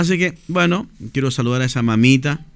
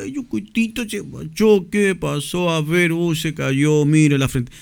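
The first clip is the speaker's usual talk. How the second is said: put-on higher, cooing to a baby; higher tender baby talk to a fallen baby; baby talk with affection; 4 semitones higher, slower than usual. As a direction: soothe a crying baby tenderly. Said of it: "hablarle con mucho cariño y amor".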